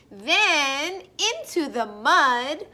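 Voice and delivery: plain reading voice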